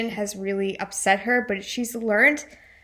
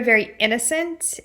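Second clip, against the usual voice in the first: ordinary talk, nothing marked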